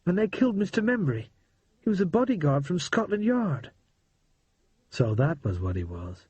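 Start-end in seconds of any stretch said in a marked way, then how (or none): 0.1-3.7 put-on higher boyish voice, tense and hurried; a scared but excited boy spilling the news; boy Percy, urgent. Percy tells Harry about the gangsters, higher boyish voice.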